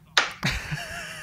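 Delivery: short high giggle at himself; a quick squeaky chuckle; high laugh. Real, not acted. Pundit giggling at his own rant. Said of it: "why are you laughing at yourself".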